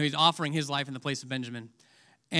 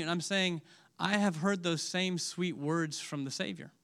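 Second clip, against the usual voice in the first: normal talking voice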